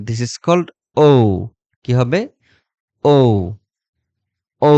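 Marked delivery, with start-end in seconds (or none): 1.0-1.1 ও drawn out, a clear o sound; correct pronunciation. Shown as a demonstration. Teacher corrects the students' habit, a long clear ও. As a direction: say ও long and slowly, not উ. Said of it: "not উ".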